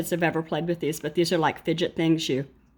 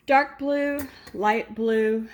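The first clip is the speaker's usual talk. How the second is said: ordinary talk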